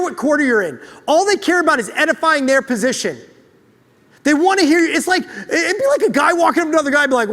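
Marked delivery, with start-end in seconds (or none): none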